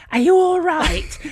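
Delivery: put-on slow, high, broad Lincolnshire accent; crazy northern accent. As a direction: call it out in a comically thick northern English accent. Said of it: "Again in that crazy accent".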